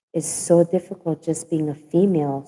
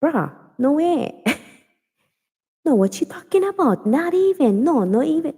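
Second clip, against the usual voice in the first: mock mad Pidgin outburst; 9 semitones higher than usual. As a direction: fire back like you're fed up, Pidgin coming out. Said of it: "when I get mad, and I'm like".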